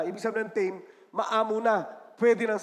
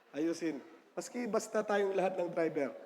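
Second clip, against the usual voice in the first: usual speaking voice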